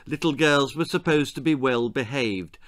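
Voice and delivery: usual speaking voice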